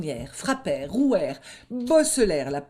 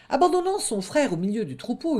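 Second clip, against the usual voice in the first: ordinary delivery, nothing marked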